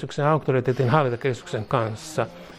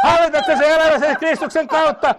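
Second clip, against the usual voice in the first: high, loud, fervent declaration; ecstatic prayer shout; 6 dB louder than usual. Real, not acted. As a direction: keep shouting the blessing at fever pitch. Resumes shouted prayer after screams.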